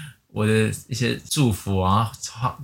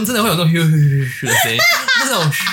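imitated snoring; 5 semitones higher than usual.

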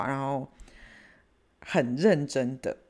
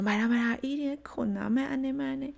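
higher, pleading mother's voice; mom pleading; 10 semitones higher than usual. Retold or imitated. Mother soothing her angry daughter, higher pleading voice. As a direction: coax her to let it go.

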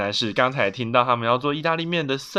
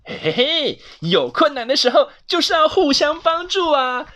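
giggly hey-hey-hey, then high and loud; gleeful raised character voice; happy bear and squirrel; 12 semitones higher, 6 dB louder than usual. Acted. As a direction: cheerfully chime in. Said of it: "小熊跟 松鼠那都开心的这样说".

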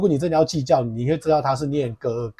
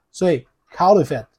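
ordinary delivery

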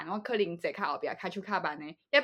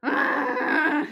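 high, loud tired zombie moan; zombie groan; 8 semitones higher, 6 dB louder than usual. Shown as a demonstration. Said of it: "这是什么丧尸的叫声吗".

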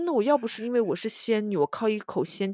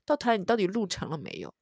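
normal talking voice

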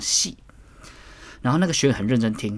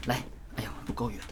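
strained whisper; 3 semitones lower, 9 dB quieter, faster than usual. Real, not acted. Struggling to set up the demo.

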